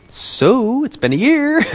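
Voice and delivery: high, hopeful imitation of a son; excited teenager. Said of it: "He's getting excited".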